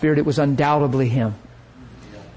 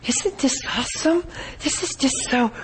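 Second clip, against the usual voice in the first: much higher, soft swooning gush rising on awesome; flaky swooning lady; 10 semitones higher, 6 dB quieter than usual. Retold or imitated. Preacher mocks the flaky lady's dreamy gushing, much higher and softer. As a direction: swoon over it, dreamy and airy. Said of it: "to me it was flaky".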